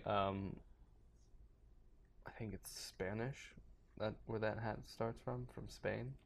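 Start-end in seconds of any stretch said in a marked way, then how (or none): 2.3-6.1 whispering. Real, not acted. Unsure aside about the hat.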